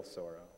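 "sorrow" with an "aw" first vowel; "sorrow" starting like "sore"; odd vowel in sorrow. Real, not acted. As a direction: say "sorrow" as SAW-roh. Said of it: "why I said that like that".

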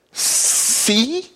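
high, mock-smug "See?"; teasing I-told-you-so squeak.

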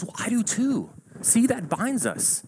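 high, eager discovery of common ground; delighted agreement. Acted. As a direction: pitch up, thrilled to have found a match.

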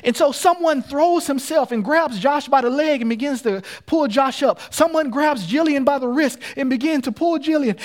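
urgent, punched-out preacher storytelling; impassioned preaching. Acted. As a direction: preach the danger with rising intensity. Preacher dramatizing the rescue.

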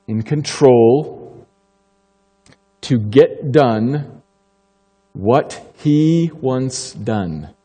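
slow, deliberate phrase by phrase; slow definition. Shown as a demonstration. Preacher defining reign for the congregation, slow and weighty. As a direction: spell it out slowly. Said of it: "Let me be clear what I mean".

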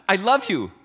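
lightly voiced dying woman, sudden and loud; a startling, loud I love you from a woman who seemed dead. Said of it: "She goes".

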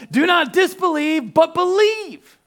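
high, loud, slow command. Acted. Jesus commanding Thomas, loud, high and slow. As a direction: proclaim it with full force.

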